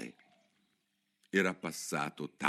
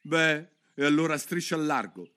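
high grumbling pigeon; 9 semitones higher, 6 dB louder than usual. Acted. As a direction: grumble the bird off. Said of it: "brontolò il piccione". (il piccione brontola scacciandola)

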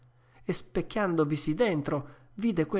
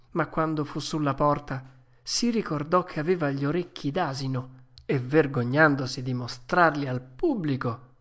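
plain reading voice

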